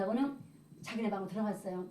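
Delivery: usual speaking voice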